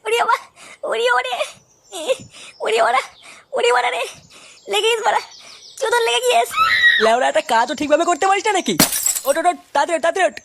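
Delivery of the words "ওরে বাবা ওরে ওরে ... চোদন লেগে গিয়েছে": crying out in pain. Acted. Cries out after getting hurt.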